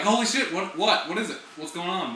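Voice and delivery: frantic squirrel wanting news; mock alarmed squirrel; voicing the squirrel. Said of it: "And he was like".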